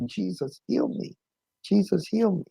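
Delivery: soft, high, pleading murmur of a plea; imitating a frail mother begging for healing; mother's hushed prayer.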